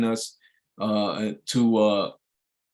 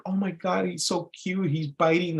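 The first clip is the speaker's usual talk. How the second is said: higher, softer cooing over a pet; mock adoring gush, pitched up; gushing dog lover; 6 semitones higher, 4 dB quieter than usual. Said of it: "some people would even think".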